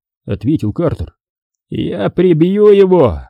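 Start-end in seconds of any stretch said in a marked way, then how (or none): 1.7-3.2 high, drawn-out angry threat; angry growl. A narrator's reading. Gang boss vows revenge on the killer, angry growl. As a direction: stretch the threat out, pitched up. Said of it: "прорычал главарь".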